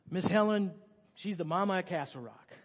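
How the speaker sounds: normal talking voice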